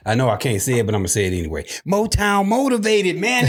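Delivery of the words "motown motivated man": hyped catchphrase. Acted. Catchphrase called out high.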